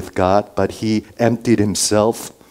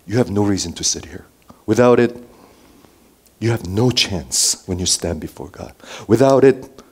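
ordinary talk

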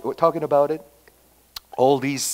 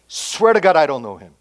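Paul Park disowning; 3 semitones higher, 8 dB louder than usual. Pretend. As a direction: swear off a close friend, acted out. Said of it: "Can you imagine Pastor Paul Park disown me".

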